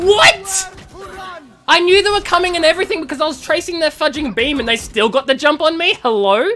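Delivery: high, loud, incredulous rant; outraged shouting. Real, not acted. Player killed despite tracking enemies, outraged shouted rant. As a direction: explode in disbelief and keep ranting.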